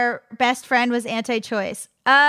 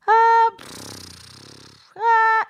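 fake sleep sighs; 10 semitones higher than usual. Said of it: "When you're, like, fake sleeping".

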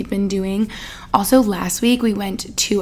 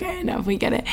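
high, quieter mock groan of fed-up fans; mock exasperated listeners; 10 semitones higher, 6 dB quieter, much faster than usual. Listeners tired of her hair talk, mock exasperated. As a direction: play the bored audience rolling their eyes. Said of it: "you guys are probably like".

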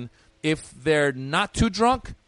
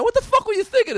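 much higher, louder exasperated outburst; angry demand; 4 dB louder than usual. Retold or imitated. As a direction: let the manager have it. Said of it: "I go to the man".

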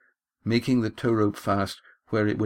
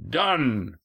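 mildly roared one-word reply; higher, restrained roar; 4 semitones higher than usual. A narrator's reading. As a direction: call it back as a skipper, voice raised in pitch. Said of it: "roared the skipper".

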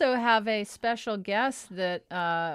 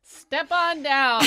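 carnival barker; 4 semitones higher, much slower than usual. Acted. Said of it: "carnival barker".

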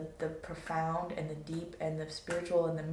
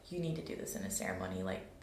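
much lower, fast voice of inner guidance; deep inner voice; 11 semitones lower, 7 dB quieter, faster than usual. Retold or imitated. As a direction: drop low like a voice from within. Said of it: "that was my download from that time, was like".

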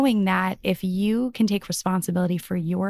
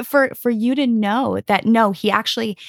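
normal talking voice

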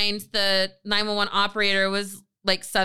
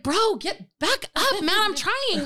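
high-pitched, exasperated plea, replayed; mock fed-up protest; 7 semitones higher than usual. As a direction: push someone off with acted exasperation. Fending off man hiding behind her.

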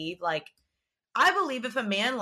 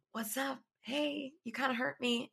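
soft, higher-pitched little aside; half-joking address; 4 semitones higher, 7 dB quieter than usual. Pretend. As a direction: say it small and quiet, as if he's listening. Mock address to him, if listening.

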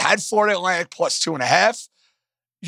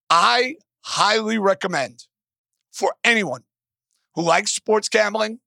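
ordinary talk, nothing marked

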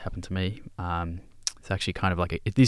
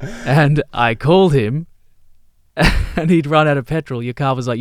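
high, loud laughing telling; laughing as she tells it; 7 semitones higher, 10 dB louder than usual. Real, not acted. Teasing her co-host about running out of petrol, laughing high and loud.

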